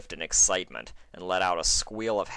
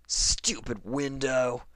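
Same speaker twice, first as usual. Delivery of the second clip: annoyed grumble; 6 semitones higher, slower than usual. Acted. Annoyed at window again.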